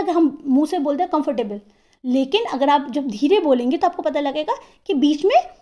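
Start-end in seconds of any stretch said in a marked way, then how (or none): 1.1-1.6 comfortable run together quickly, no gap; comfortable as one unbroken word; said at once. Shown as a demonstration. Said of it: "एकदम से नहीं".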